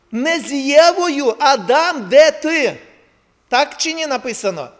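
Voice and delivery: loud, high, impassioned sermon; fiery preaching. Acted. Preacher urging mothers to be watchmen at home, loud and fervent. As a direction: drive it home to the congregation.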